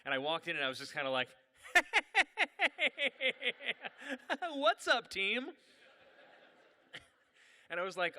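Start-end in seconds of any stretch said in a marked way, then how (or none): 1.6-4.5 high, loud staccato nervous laugh; mock bewildered cackle. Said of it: "bewildered by what I walked into". 4.5-5.5 high, loud, awkward greeting; acted-out flustered hello; bewildered greeting. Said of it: "bewildered by what I walked into".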